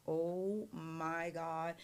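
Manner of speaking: very slow, hushed amazement; awed reaction. Acted. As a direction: stretch each word, softly stunned. Singer awed, takes off hat.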